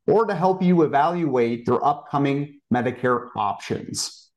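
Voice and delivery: usual speaking voice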